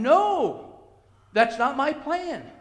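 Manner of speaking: high, loud "No", then slow and firm; firm divine refusal. Acted. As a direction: overrule him as God. God's acted refusal of Abraham.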